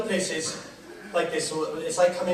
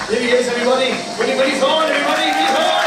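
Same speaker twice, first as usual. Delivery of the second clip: higher, louder showman announcement; announcing; 6 semitones higher, 5 dB louder, much faster than usual. Real, not acted. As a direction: present the guest to the room. Announcing a celebrity to the crowd.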